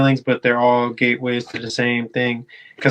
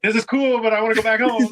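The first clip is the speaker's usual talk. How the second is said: higher-pitched pretend reluctance; mock uneasy tripper; 8 semitones higher, faster than usual. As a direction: politely beg off in a raised voice. Acts out wanting to leave trip.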